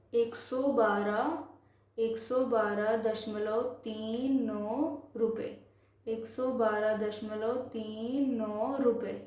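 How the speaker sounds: slow, measured Hindi number reading; slow reading demonstration. Shown as a demonstration. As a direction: draw out "तीन" and "नौ" as separate digits. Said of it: "तो इसको कैसे पढ़ेंगे".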